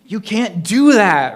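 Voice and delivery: high, very loud slow shout. A narrator's reading. Rhonda shouting at the ghost's plan, loud shout. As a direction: yell it in protest. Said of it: "Rhonda shouted".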